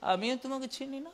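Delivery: soft, cold voice of the Lord; quiet God voice. Retold or imitated. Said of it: "প্রভু কি বলবে?".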